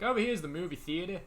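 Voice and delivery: put-on Australian accent. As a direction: imitate an Australian guide pointing out sights. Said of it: "same thing of, like, certain words".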